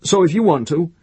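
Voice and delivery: sudden high, loud burst; abrupt. A narrator's reading. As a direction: blurt it out abruptly. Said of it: "then said abruptly".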